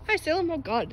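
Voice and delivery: ordinary delivery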